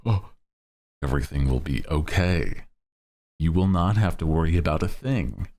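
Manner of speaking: calm words, menacing undertone; ominous reassurance. Pretend. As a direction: reassure them like a threat. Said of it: "but, like, the, the tone".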